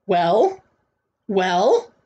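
expectant rising question; demanding. A narrator's reading. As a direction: press for an answer with a rising end. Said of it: "he demanded".

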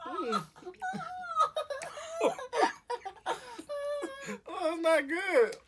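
high pained laughing squeal. Real, not acted. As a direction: laugh and wince at the sourness. Reaction to sour Warhead.

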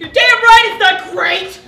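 loud, drawn-out self-loathing yell; high, loud and exasperated; angry outburst. Acted. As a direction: blow up in furious agreement. Snaps at parents over grades.

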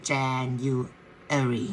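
January stretched very slowly, each syllable drawn out. Shown as a demonstration. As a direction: spell out January slowly for learners. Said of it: "ช้าๆนะ". (พูด January ช้าๆ ทีละพยางค์)

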